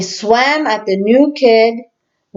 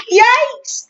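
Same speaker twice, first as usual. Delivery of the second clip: high startled yelp of yikes; startled cry; 14 semitones higher than usual. Acted. Friend startled by the swing, yelping. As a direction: jump at the push with a yelp. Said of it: "cried Joey Mackerel".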